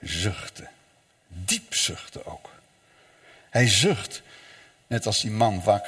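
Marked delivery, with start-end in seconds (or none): 0.0-2.5 soft, low, sighing; sighed. Shown as a demonstration. Preacher explaining the verb, softly sighing it. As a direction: breathe the words out as a deep sigh. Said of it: "heel verdrietig zuchten".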